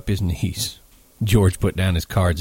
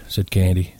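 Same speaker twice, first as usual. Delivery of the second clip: ordinary delivery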